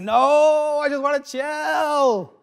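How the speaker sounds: loud, high, drawn-out mock whine; wailed protest. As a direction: wail it slowly like a thwarted vacationer. Acted protest as accent gets closer.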